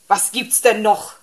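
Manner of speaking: loud, irritated shout into the phone; sudden burst of annoyed volume; bellowing. A narrator's reading. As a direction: bellow it down the receiver. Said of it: "brüllte er in den Hörer".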